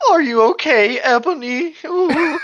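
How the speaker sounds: high, loud cartoon voice; high-pitched slacker impression, near laughing; Shaggy. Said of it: "doing the Shaggy thing".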